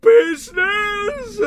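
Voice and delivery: a high, drawn-out wailing shout; anguished cry. Acted. Marley's ghost cries out against Scrooge's praise of business. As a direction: howl the word in torment. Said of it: "cried the ghost".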